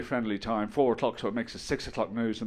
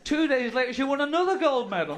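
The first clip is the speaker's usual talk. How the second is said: loud, much higher-pitched announcement; worked-up retelling; 12 semitones higher, 5 dB louder than usual. Shown as a demonstration. Comedian retelling the swimmer's second win, loud incredulity. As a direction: push it high and loud in disbelief.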